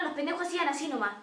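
ordinary talk

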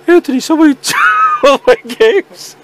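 high, whiny complaint, laughing through it; mock AJ whining. Imitating AJ whining to Anthony about stolen games. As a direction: whine like a kid who lost everything. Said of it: "and A J will be like".

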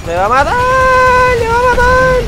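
high, drawn-out scream of panic; panicked scream. Real, not acted. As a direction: scream it in sheer terror. Enemy closing in, panic. (grito de pánico agudo y alargado)

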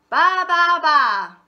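high loud bleating baa; sheep bleat. A narrator's reading. Hen pretends to be sheep.

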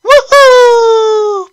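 high, loud thrilled whoop; joyful whoop. Acted. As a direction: let out a wild cheer of joy. Prince starts driving thrilled.

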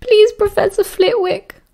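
high, slow tearful pleading; sobbing plea. A narrator's reading. Tina begging the professor to go home, tearful and high. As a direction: beg through tears to be let go. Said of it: "Tina sobbed".

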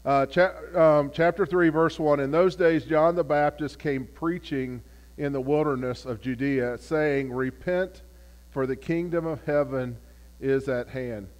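usual speaking voice

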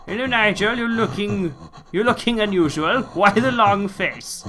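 high, loud mock cheery greeting; silly greeting voice. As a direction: ham up a friendly hello. Greets the creature mockingly.

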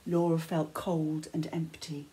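ordinary delivery

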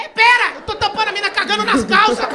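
high, loud, frantic outburst; desperate yelling. Acted. Covering her eyes while the crew stalls, desperate shouting. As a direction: yell it at the end of your rope.

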